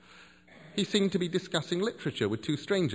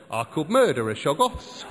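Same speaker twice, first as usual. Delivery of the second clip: slogan pitch; 4 semitones higher, 8 dB louder than usual. Acted. Repeats as advertising slogan.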